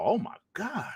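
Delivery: ordinary talk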